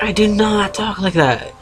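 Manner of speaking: high-pitched mock protest; imitating her own disbelieving objection; indignant denial. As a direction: act out an offended denial, pitched well up.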